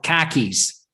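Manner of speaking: "khakis" as Boston "car keys", R dropped; slow Boston punchline, "khakis" sounding like "cah keys"; Boston accent. Shown as a demonstration. Punning on car keys in a mock Boston accent. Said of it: "the way they pronounce the R's".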